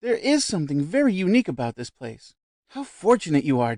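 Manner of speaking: high, eager society lady; lightly voiced effusive visitor; bright chatty lady at dinner; gushing guest. A narrator's reading. Gushing Mrs Stapleton voice.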